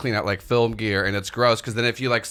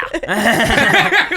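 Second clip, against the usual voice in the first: high-pitched burst of laughter; 11 semitones higher, 7 dB louder than usual. Real, not acted. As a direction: squeal with laughter.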